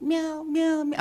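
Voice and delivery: high, pitiful mewing like a frightened kitten; crying kitten. Retold or imitated. Imitating the kitten crying in the car, high mewing. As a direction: mew it out high like a kitten wailing in the box. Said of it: "it's like".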